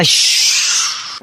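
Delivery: slow whispered shh to set a flashback; long hushing shh. Acted. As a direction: hush the listener before the story.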